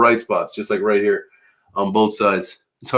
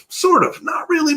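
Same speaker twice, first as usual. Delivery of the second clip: "Sort of" in a much higher squeaky voice. Shown as a demonstration. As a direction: jokingly squeak "Sort of" way up high.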